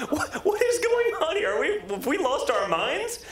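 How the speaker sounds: high, disbelieving outburst; incredulous disbelief. Retold or imitated. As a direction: ask it like you can't believe it. Retold disbelief, high pitch.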